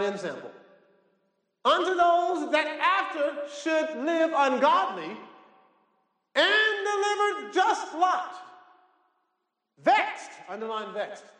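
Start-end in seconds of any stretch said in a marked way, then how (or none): 1.7-10.3 very high, drawn-out declamation; preached scripture at full force. Acted. Preacher reading of judgment to the congregation, high-pitched proclamation. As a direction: proclaim it high and slow, phrase by phrase.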